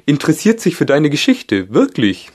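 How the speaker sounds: grand creature announcement; gryphon voice. A narrator's reading. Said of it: "sagte der Löwengreif". (Greifenstimme, hoch, laut, langsam)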